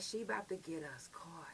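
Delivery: breathy worried whisper to herself; whispered thought. Retold or imitated. Whispering her fear while cuffed in the cell. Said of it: "in my head".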